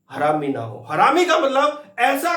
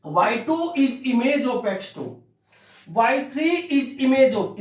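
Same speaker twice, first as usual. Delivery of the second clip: slow, spelled-out reading of each "is image of" line; slow reading aloud; 4 semitones higher, slower than usual. Shown as a demonstration. Teacher showing how to read it aloud, slowly. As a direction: read it out word by word for the students. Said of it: "हम इसको ऐसे पढ़ते हैं".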